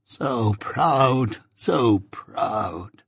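softened, long drawn-out moan; weary, grieving lament; low moan. A narrator's reading. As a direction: let each word sag and linger in sorrow. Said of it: "moaned Miss Havisham".

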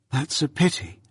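regretful, shaking her head; gently sorrowful. A narrator's reading. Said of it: "shaking her head with a sorrowful air".

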